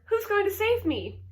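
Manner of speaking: high, loud eager question; eager cry. A narrator's reading. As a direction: ask with desperate hope. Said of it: "cried Wilbur".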